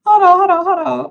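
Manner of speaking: high, loud excited squeal; bursting with happiness; overjoyed. Real, not acted. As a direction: gush with pure joy. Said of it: "I was so happy".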